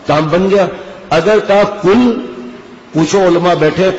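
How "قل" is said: قل said with a front k, as kul; qaf as kaf. Shown as a demonstration. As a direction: say قل with k instead of throat q. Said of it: "کھاؤ".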